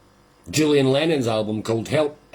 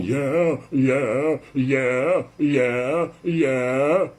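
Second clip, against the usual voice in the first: mimicking John; much slower than usual. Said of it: "John's going".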